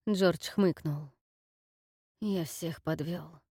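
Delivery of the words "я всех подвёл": slow, soft exhaled words; breathed out. Acted. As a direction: let it out on a weary breath. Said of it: "выдохнул он".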